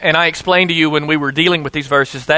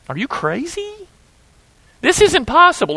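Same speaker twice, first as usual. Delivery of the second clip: incredulous; 11 semitones higher than usual. Acted. Imagined listener's disbelief.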